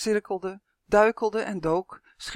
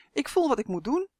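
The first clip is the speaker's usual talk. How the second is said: put-on eager boy; 8 semitones higher than usual. A narrator's reading. Boy Justin answers giant.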